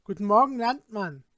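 loud, trying to sound brave; forced bravado. A narrator's reading. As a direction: fake courage over fear. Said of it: "unerschrocken zeigen wollte, aber heftig zitterte". (gespielter Mut, zitternd)

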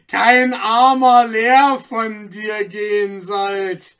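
high, loud taunting verse, slow in places; sing-song it to mock him; mocking rhyme. A narrator's reading. Anton taunting the magistrate in verse, loud scornful rhyme.

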